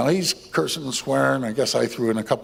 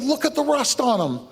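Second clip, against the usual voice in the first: much higher, frantic pleading; pleading thief; 11 semitones higher than usual. Retold or imitated. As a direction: squeal the thief's desperate oath. Thief pleading, voiced high.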